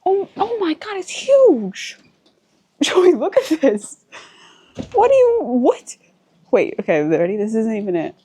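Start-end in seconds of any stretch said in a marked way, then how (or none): 0.0-6.0 much higher, louder, squealing with delight; thrilled squeal. Real, not acted. Vlogger calls Joey out to the huge balcony, thrilled squeal. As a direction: shriek it like the balcony just blew your mind.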